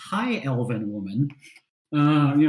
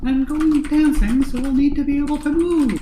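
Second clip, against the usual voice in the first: high, loud, lively lady voice; high elf lady voice; 9 semitones higher, 5 dB louder than usual. Acted. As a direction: chirp like an excited noblewoman.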